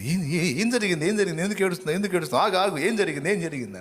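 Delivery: startled and worried, firing questions at his crying wife; stunned husband. Acted. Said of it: "ఈయన stun అయిపోయాడు". (కంగారుగా, ఎత్తైన స్వరంతో ఆందోళన)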